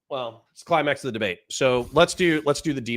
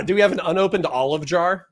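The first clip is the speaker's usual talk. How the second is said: ordinary delivery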